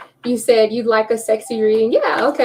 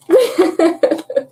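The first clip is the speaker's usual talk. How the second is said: laughing; 7 semitones higher, 4 dB louder than usual. Real, not acted. Laughing mid sentence.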